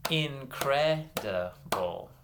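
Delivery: INCREDIBLE slowly, a gap after every syllable. Shown as a demonstration. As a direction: clap out "Incredible" piece by piece. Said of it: "clap out some syllables".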